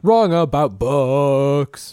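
long drawn-out, loud show title; segment title call. Acted. Host announcing Wrong About Books, drawn-out title call. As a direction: stretch it out like a theme intro. Performing the segment's theme music.

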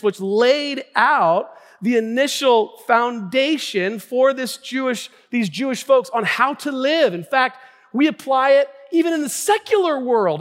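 rising from firm to high, loud fervor; building preaching. Real, not acted. Pastor citing Moses and prayer to congregation, building preaching. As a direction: build momentum, leaning hard on key words.

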